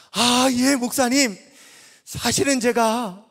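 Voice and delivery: loud, slow, emphatic declaration; proud announcement. Retold or imitated. Young man proudly telling the old pastor he is ordained. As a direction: proclaim it with pride.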